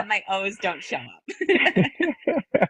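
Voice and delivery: laughing through the words. Real, not acted. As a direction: crack up at your own joke while talking. Laughing at her own accent joke.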